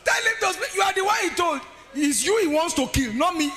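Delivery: high, loud comic protest; indignant retort. Retold or imitated. Wife telling husband the threat is his alone, indignant shout. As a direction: shout it back in mock outrage.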